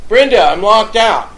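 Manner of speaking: loud shout to be let back in; yelling up. Retold or imitated. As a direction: call up to someone upstairs. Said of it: "I start to yell up to Brenda".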